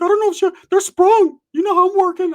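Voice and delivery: way up high and loud, cocky friend bragging; loud high cartoonish bro voice; high-pitched Ricky voice. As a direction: squeak it out like a boastful buddy. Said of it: "He's like".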